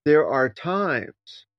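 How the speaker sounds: There are times, drawn out very slowly; slow, weighty emphasis on "There are times"; emphatic underlining. Real, not acted. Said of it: "Let me underline this".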